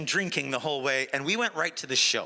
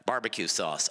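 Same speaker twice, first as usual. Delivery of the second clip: low deadpan; 12 semitones lower than usual. Retold or imitated. T-Rex's punchline deadpan.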